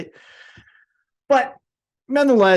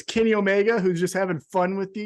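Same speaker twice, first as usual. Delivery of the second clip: ordinary delivery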